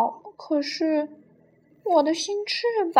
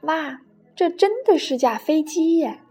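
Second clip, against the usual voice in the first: higher, wowed storybook voice; delighted; 7 semitones higher, 7 dB louder, slower than usual. A narrator's reading. Penguin seeing the real plane, delighted. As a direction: exclaim in happy wonder. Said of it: "达芬奇高兴地说".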